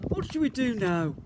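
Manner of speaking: a lightly voiced skeleton asking eagerly; big skeleton. A narrator's reading.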